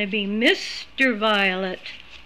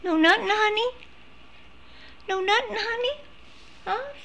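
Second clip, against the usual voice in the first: much higher, teasing coax; 11 semitones higher, faster than usual. Real, not acted. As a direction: coax the silent pet in a high sing-song. High voice to pet.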